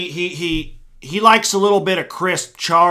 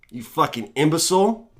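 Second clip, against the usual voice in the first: ordinary delivery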